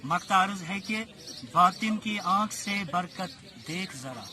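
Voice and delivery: measured mushaira verse recital; poetry recitation. Acted. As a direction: recite the couplet with phrase pauses.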